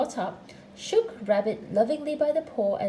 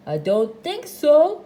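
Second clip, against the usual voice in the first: loud, slow put-on denial; a hint of disguise, raised and drawn out; lightly disguised voice; 4 semitones higher, 10 dB louder, slower than usual. A narrator's reading. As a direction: deny it in a mildly false voice. Rabbit keeps disguising his voice.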